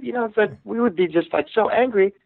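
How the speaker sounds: ordinary delivery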